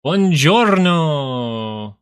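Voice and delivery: slow, drawn-out Italian buongiorno; mock Italian buongiorno; Italian greeting. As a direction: ham up an Italian hello. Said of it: "sudden Italian greeting".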